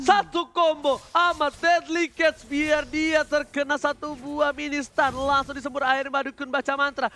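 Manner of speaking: loud, high combo call; excited play-by-play. Acted. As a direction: hype the combo live.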